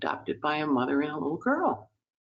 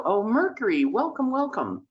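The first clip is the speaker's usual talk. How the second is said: welcoming Mercury; 8 semitones higher than usual. Pretend. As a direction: greet him like a dear guest. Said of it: "you wanna kind of go".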